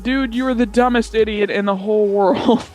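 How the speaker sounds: ordinary talk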